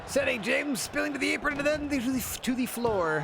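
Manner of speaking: wrestling commentator. Acted. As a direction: shout the big spot like live commentary.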